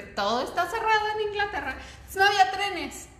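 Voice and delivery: high-pitched, incredulous outburst; exasperated exclamation. Retold or imitated. Storyteller recalling Christmas Day with no trains, high exasperated exclamation. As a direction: raise the pitch right up in dismay.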